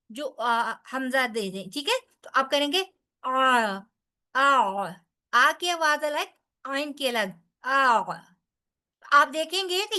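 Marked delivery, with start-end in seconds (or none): 2.2-8.0 آ pressed from the mid throat, strained; ayn sound demo. Shown as a demonstration. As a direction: squeeze the آ from the throat. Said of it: "اس کو ساکن کر لیں آئین کو". (حلق سے عین کی آواز)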